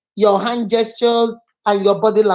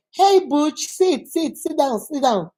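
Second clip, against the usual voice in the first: higher, easy repeated invitation to sit; friendly invitation; 4 semitones higher than usual. Shown as a demonstration. Host asking Butch to sit down, friendly. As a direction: urge them lightly to take a seat. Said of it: "So you see, so does it make a difference?".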